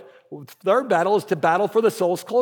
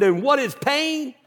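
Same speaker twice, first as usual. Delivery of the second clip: high, slow mock drill call; mock coach question; 7 semitones higher than usual. Pastor to someone in hallway, mock coach holler. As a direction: playfully holler it like the coach, pitched up. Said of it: "would have looked at you and said".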